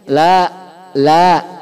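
la held long and firm. Shown as a demonstration. Teacher showing the la read long. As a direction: stretch the la out. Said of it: "la-nya panjang".

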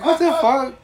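high, loud startled what the; shocked outburst. Retold or imitated. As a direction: blurt it out in disbelief. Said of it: "You're like".

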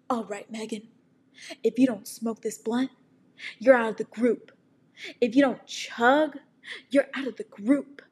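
lightly voiced bullying cool kid; mock peer pressure. Imagined friend pressuring Megan, mock threat. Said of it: "were like".

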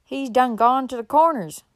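put-on loud rustic child's reply; backwoods urchin. A narrator's reading. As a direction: call back like a backwoods kid. Urchin answers horseman from door.

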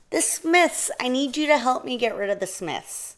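high, pleading little mouse voice; urgent mouse plea. A narrator's reading. Mouse begging a bear for help, high urgent plea. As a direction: plead high, falling at the end.

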